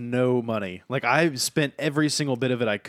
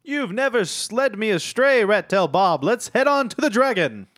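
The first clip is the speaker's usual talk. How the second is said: raised, louder knightly voice; high character voice; 10 semitones higher, 7 dB louder than usual. Acted. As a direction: speak up high as the eager knight. Player voicing Sir Don in character.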